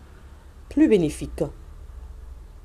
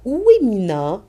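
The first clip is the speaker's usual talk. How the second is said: question and answer demo; 5 semitones higher than usual. Shown as a demonstration. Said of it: "on peut poser la question à l'enfant".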